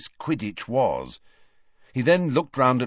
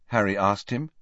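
ordinary delivery, nothing marked

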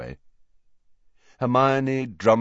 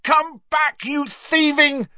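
furious high, loud shout; full-throated angry bellow, pitched high; top of his voice; 6 dB louder, slower than usual. A narrator's reading. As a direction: yell after a fleeing thief. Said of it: "Harry swore at the top of his voice".

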